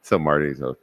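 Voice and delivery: ordinary delivery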